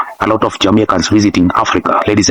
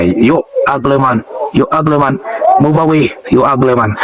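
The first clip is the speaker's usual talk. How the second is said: higher, playful Jamaican patois call; imitated Jamaican patois; 5 semitones higher than usual. Jamaican calling another guy ugly, in playful patois. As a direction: lightly imitate a witty Jamaican patois tease. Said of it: "he was calling another guy".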